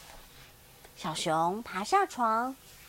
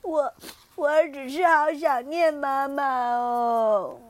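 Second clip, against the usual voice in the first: sniffly sad; 5 dB louder than usual. Acted. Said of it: "小长颈鹿醒醒鼻子说".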